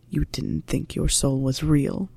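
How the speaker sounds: low, soft, slow inner voice; inner thought. Acted. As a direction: think it quietly to yourself. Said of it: "thought Severus".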